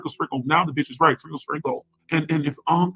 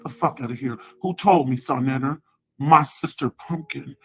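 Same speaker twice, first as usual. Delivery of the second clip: normal talking voice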